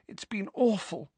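high, soft, sad voice. Acted. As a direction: say it small and sorrowful. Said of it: "said Ginny sadly".

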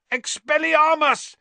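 high, loud spell cry; yelled. A narrator's reading. Harry casts a spell at Pettigrew, yelling. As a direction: yell the spell with full urgency. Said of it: "Harry yelled".

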